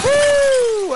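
high, loud, sustained woo; long whoop. Real, not acted. Player evading Jason, high wild whoop. Whoop while taunting killer.

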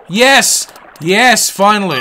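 elated yell of relief; triumphant. Real, not acted. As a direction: punch out each yes in triumph. Fish finally hooked.

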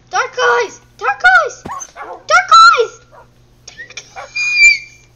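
loud call climbing to a shriek; shrill, escalating pet call. Real, not acted. Calling the pet, getting higher.

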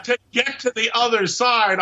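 the alien cracks up at his joke; alien laugh voice. Acted. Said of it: "I laugh with my alien voice".

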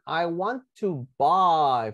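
very slow, drawn-out words; slow model phrase. Shown as a demonstration. Teacher points out an expression to students, slow model. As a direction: spell it out slowly for learners. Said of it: "Fíjense esta expresión".

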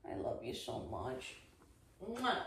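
very soft, low murmur; hushed, doting pet-talk; tender affection. Real, not acted. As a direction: murmur love to a puppy, then kiss. Doting on puppy, blows kiss.

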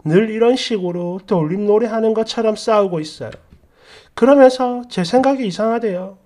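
plain reading voice

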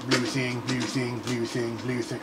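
spaced-out Spock. Retold or imitated. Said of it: "Mister Spock was getting high off that thing".

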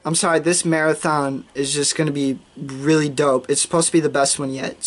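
normal talking voice

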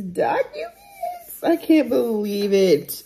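tearful disbelief, pitch lifted; real, on the edge of crying, voice rising; emotional. Real, not acted. Buyer about to sign offer documents, emotional and tearful. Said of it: "it just makes me emotional".

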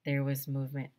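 ordinary delivery

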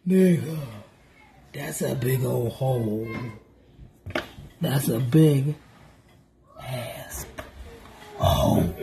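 deep, slow inner voice, dropping to a whisper at the end. Retold or imitated. To herself, seeing the hole the kids made, low and stunned. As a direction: mutter it low to yourself in disbelief. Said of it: "I'm looking like".

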